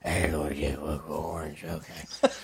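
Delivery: voiceless, strained whisper of a man who lost his voice; sick whisper. Retold or imitated. Answering the roommate with a bronchitis-wrecked whisper. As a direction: rasp it out with no voice left. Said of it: "I was actually like".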